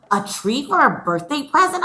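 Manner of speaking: can't believe the gift; surprised question. Acted. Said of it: "I said".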